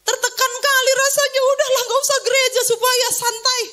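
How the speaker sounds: loud-edged, high venting of someone worn down; exasperated complaint. Acted. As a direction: let the frustration burst out, pitched high. Voicing the pressured family's complaint.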